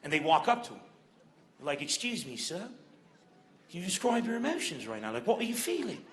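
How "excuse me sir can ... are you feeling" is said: put-on polite British accent of a TV reporter. BBC reporter asks old man his feelings, British accent. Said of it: "how I got my British accent".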